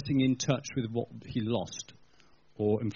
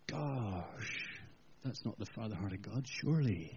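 hushed dismay; 4 semitones lower, 9 dB quieter, slower than usual. Acted. Shocked hushed aside.

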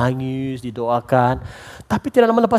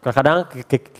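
usual speaking voice

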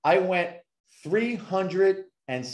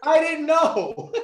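much higher, louder, laughing through the words; 4 dB louder, slower than usual. Real, not acted. Guest laughing at himself to host Crystal, real laughter. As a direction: crack up at your own story.

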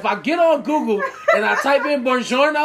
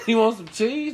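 normal talking voice

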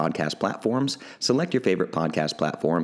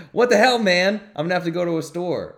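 much higher, louder exclamation of disbelief; mock outrage; 10 semitones higher, 6 dB louder than usual. Host lamenting his last Topo Chico, mock outraged shout. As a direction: throw up your hands in playful outrage.

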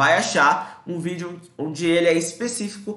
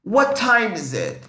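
slow teaching demo; 5 semitones higher, 3 dB louder, slower than usual. Shown as a demonstration. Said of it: "Esse calm down a gente já viu".

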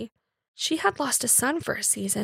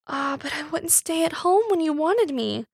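tearful; 5 semitones higher than usual. A narrator's reading. Wife weeping in story.

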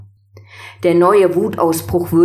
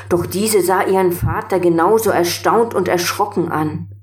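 usual speaking voice

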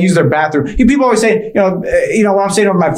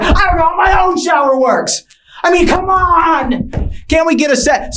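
exasperated rant; 10 semitones higher than usual. Acted. Comic to audience about hotel showers, high exasperated shout. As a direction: throw up your hands at the shower.

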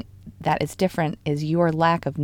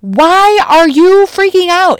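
slow, high-pitched shouted retort; yelling; 13 semitones higher, 13 dB louder, slower than usual. Acted. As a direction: holler it back over the storm, drawn out. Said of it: "like, this yelling thing".

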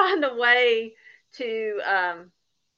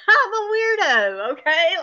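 laughing; 7 semitones higher, 6 dB louder than usual. Real, not acted. Laughing self-mockery.

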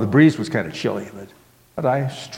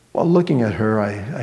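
ordinary delivery

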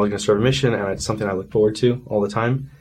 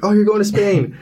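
much higher, excited mimicry; pitched-up mock excitement; excited retold reaction; 11 semitones higher, 4 dB louder than usual. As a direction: imitate everyone's eager wrong assumption.

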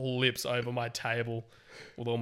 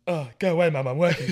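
mock irritation; 5 semitones higher, 10 dB louder than usual. Joking acted quote, shooing mum away.

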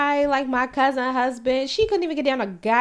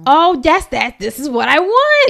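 loud, high and thrilled; excited outburst; 5 semitones higher, 10 dB louder than usual. Real, not acted. Bride-to-be reacting to a wedding look they love, excited. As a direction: exclaim it, louder and higher.

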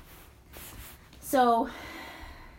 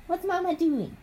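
playful, higher-pitched parent voice; 7 semitones higher than usual. Real, not acted. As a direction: coax a little one to answer. Mother prompting her toddler.

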